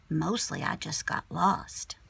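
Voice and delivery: ordinary delivery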